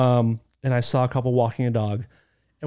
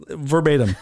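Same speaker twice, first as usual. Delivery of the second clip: normal talking voice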